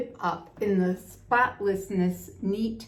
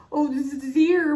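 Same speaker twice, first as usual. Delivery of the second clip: moaning; 7 semitones higher, 6 dB louder than usual. A narrator's reading. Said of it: "moaned the scientist".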